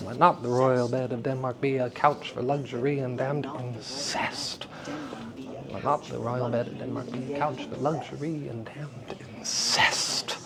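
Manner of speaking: low, soft verse, "incest" bursting on the second syllable; iambic stress. Shown as a demonstration. Teacher repeating exercise softly, stress on the end of "incest". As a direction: murmur the line, let the "cest" of "incest" leap out. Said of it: "You see how cest almost wants to leap out of the mouth".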